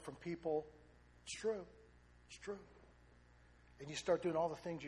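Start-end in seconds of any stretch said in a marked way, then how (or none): 2.3-2.6 quiet echo. Shown as a demonstration. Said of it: "repeats it".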